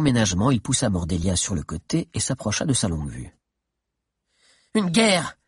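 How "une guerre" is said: high-pitched exclamation; crying out. A narrator's reading. Adviser protesting to her chief, crying out. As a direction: cry it out, pitch jumping up. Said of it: "cria Mordelia".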